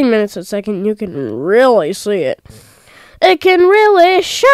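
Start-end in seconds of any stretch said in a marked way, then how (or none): 3.2-4.5 a sudden high, loud exclamation; odd exclaim. Real, not acted. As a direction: blurt it out, higher and louder. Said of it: "I don't know why I said it like that".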